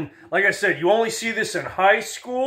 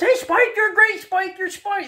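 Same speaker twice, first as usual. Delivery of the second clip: much higher, yappy cartoon sidekick voice; yappy little dog; 13 semitones higher than usual. Little chihuahua hopping round the pit bull, high yappy cartoon voice. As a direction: yap up at the big dog like a tiny fan. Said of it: "like that little dog in the Looney Tunes".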